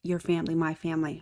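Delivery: normal talking voice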